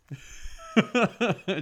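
big burst of laughter; loud laughter. Real, not acted. Laughs hard at joke.

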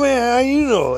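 high, loud delighted amazement; real happy. Retold or imitated. Blind man amazed at the right guess, imitated happy outburst. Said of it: "he was real happy".

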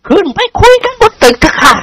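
high-pitched, inviting lady's voice; gracious hostess, pitched high; smiling lady. A narrator's reading. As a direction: invite them politely with a smile. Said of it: "คุณหญิงวาดยิ้มให้เจ้าคุณทั้งสอง".

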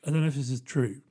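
ordinary delivery

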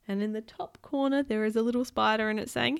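ordinary delivery, nothing marked

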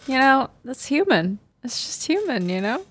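high, soft put-on comforting voice; mock soothing. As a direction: coo it as feigned reassurance. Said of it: "She's like".

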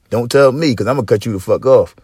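ordinary talk, nothing marked

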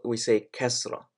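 usual speaking voice

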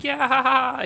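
put-on high, giggly little kid; child voice. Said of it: "so Basil's like".

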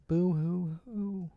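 hammed-up boo hoo sob; fake crying. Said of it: "I do this".

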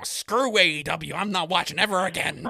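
hammed-up disgruntled fan; mock commenter rant. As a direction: drag it out like a whiny fan quitting. Mocking angry commenters.

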